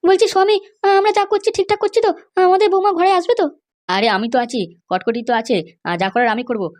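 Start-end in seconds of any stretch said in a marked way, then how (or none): 0.0-3.5 much higher-pitched woman's voice; a high female character voice; mother's voice. Acted. Wife asks husband anxiously.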